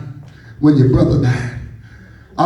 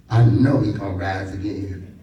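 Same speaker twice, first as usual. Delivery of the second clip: a little attitude; 9 semitones lower, 7 dB quieter than usual. Retold or imitated. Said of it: "she has a little attitude".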